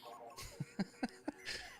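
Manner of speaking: short breathy nervous laugh; an awkward, airy little laugh. Real, not acted. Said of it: "It's my nervous laughter".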